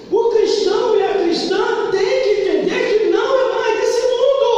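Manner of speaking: high, loud, chanted preaching; full-force preaching. Acted. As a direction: lift it into a sung cry.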